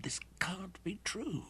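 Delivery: slow whisper, pitch lifting in disbelief; stunned whisper. A narrator's reading. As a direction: breathe it out, unable to accept it. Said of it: "he whispered".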